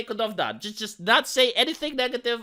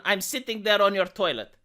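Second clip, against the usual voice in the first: ordinary talk, nothing marked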